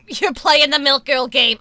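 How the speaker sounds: ordinary talk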